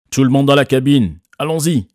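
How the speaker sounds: father shouts over the storm, high and loud; shouted order. A narrator's reading. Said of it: "a ordonné mon père".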